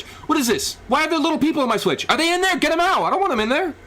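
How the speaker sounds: high, frantic outburst; rising into a frantic high rant; comic panic. Real, not acted. Comic panic at little people.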